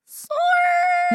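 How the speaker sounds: squealed sorry far up in pitch; squeaky high sorry breaking into a laugh; high note. Real, not acted. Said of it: "Hitting them high notes".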